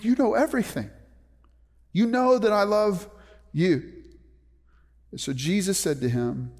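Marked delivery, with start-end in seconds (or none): 0.0-3.9 higher-pitched, earnest protest; grieved insistence. Acted. Grieved Peter answering the third question, pleading insistence. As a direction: plead to be believed, pitch raised. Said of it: "And Peter was grieved".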